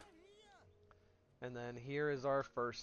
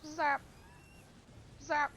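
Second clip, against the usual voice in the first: playful high zaps. Pretend. Zapping wasps playfully.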